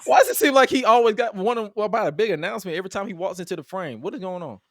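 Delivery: acted-out bafflement, rising high and loud; exasperated disbelief. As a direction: build into a comically fed-up outburst. Acts out baffled reaction to recurring gimmick.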